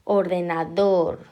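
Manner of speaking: ordenaDOR, slow with a loud, long DOR; stress on last syllable. Shown as a demonstration. Teacher showing it is the last, ordenador stressed on DOR. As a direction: hit and stretch DOR in ordenador. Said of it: "para que veáis que es la última".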